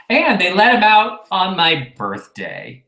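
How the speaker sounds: usual speaking voice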